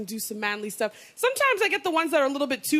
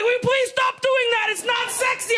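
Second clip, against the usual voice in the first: mock annoyed man; 10 semitones higher than usual. Sweaty lover complaining to her in bed, high indignant shout. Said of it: "he's like".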